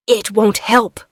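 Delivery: ordinary delivery, nothing marked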